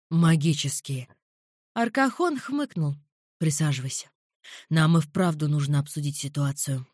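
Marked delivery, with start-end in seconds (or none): 0.1-1.1 lower, darker man's voice; low male voice. A narrator's reading. Alvir answers. 3.4-6.9 lower, darker man's voice; low male voice. A narrator's reading. Alvir speaks.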